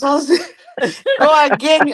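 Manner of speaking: laughing through the words. Real, not acted. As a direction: chuckle as you say it. Laughing during banter.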